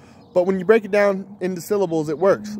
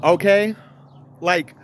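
ordinary delivery

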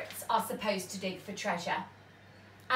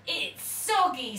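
pirate complaining; 4 semitones higher, 5 dB louder than usual. A narrator's reading. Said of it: "said Percy Patch".

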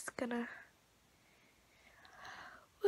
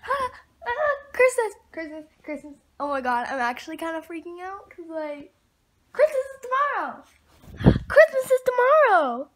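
high gasping squeal, much louder; squealing giddy; excited squeal; 3 semitones higher, 6 dB louder than usual. Real, not acted. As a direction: burst with Christmas excitement. Sudden Christmas excitement squeal.

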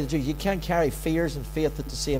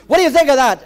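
high, loud demanding question; worked-up appeal to God; indignant complaint; 7 semitones higher, 10 dB louder, faster than usual. Acted. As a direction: throw it out as a challenge. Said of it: "I have an old complaint".

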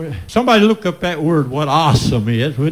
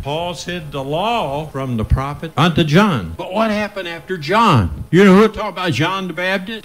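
ordinary talk, nothing marked

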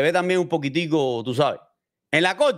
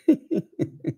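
short laugh; 7 semitones higher than usual. Real, not acted. Incredulous laugh at own point.

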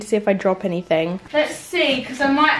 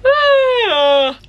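high, loud exasperated cry; frustrated high yelp; 12 semitones higher, 6 dB louder than usual. Real, not acted. Venting to viewers about her busy week, a frustrated high yelp. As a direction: let out a sharp squeal of frustration.

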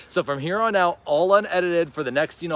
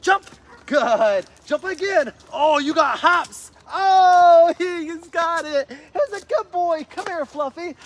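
high, loud, thrilled cheering with a laugh; excited praise; 10 semitones higher, 4 dB louder, slower than usual. Shown as a demonstration. Trainer showing voice inflection while his dog jumps. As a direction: cheer every jump with big inflection. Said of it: "Use that voice inflection".

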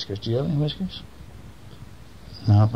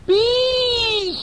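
very high squeal of bees; high startled mouse squeal; alarmed mouse cry; 3 dB louder than usual. Acted. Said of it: "said the mouse".

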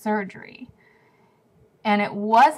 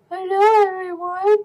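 high, loud squeaky pet voice; cat voice; 10 semitones higher, 6 dB louder than usual. Pretend. Cat voice greeting viewers.

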